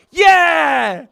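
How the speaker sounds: triumphant shout; imitating a scream. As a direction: bellow it like the fighter in his corner. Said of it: "in his corner screaming".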